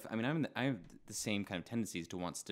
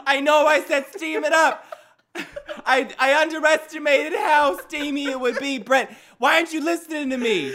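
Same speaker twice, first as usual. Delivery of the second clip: high whiny complaint; 16 dB louder than usual. Acted. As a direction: whine at Brent who isn't listening. Scolding Brent in sauna skit.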